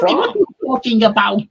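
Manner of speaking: hammed-up German impression; mock German accent. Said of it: "you know, that German".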